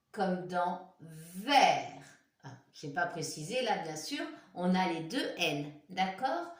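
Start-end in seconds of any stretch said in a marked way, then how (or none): 0.1-2.0 comme dans verre drawn out slowly; slow example word. Shown as a demonstration. Teacher giving an example word, slowly. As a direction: stretch the example so it sinks in.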